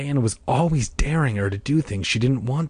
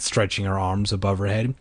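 usual speaking voice

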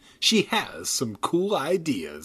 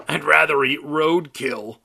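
rasping dummy; 5 dB louder than usual. A narrator's reading. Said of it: "he rasped".

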